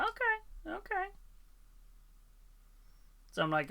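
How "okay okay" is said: much higher, mock-impressed; squeaky okay, okay; high silly voice.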